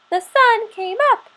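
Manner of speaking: high chirping little squirrel voice. Acted. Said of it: "he chirped".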